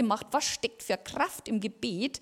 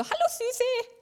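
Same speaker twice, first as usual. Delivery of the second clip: very high-pitched mock greeting; delighted greeting; slower than usual. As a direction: imitate a gushing, sing-out hello. Colleague's happy greeting, acted out. (hohe, überschwänglich nachgeahmte Begrüßung)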